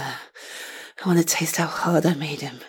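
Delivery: soft slow breathy whisper. Acted. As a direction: lean in and whisper it slowly. Teasing intimate aside.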